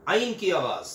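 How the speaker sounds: ordinary talk, nothing marked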